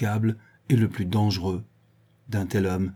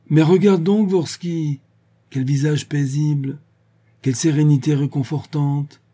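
high, loud mock admiration; mocking exclamation; 6 semitones higher, 7 dB louder than usual. A narrator's reading. Old druid taunts Vorsky about the peaceful face, mocking exclamation. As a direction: exclaim it in a raised, teasing voice.

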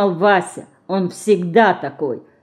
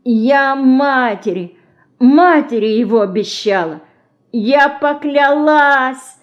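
slow, higher anguished moan; 6 semitones higher, 4 dB louder, slower than usual. A narrator's reading. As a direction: drag out the vow in despair. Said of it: "простонала Динка".

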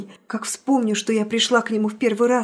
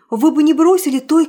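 pleading outcry; 5 semitones higher, 8 dB louder than usual. Acted. Nastenka's pleading reproach.

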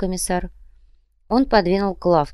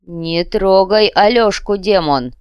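louder, slow furious cry; desperate rage; 4 semitones higher, 8 dB louder, slower than usual. A narrator's reading. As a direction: beg and threaten at once, shouting. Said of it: "Руки старца задрожали".

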